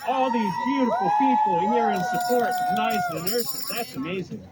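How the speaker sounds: high, loud delight at the crowd; excited marvel. Real, not acted. Doctor admires the crowd of nurses, excitedly. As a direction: gush over the sea of people.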